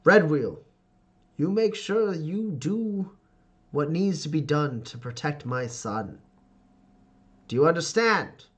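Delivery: hearty laugh into grand, drawn-out pronouncements; Ferdinand, domineering father. Acted. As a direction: boom approval, then give a stern order.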